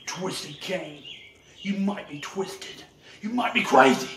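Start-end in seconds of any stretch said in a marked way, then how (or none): 1.6-4.1 sudden yell on crazy; shout. Acted. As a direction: bark it at your rival. Threatening rival in promo.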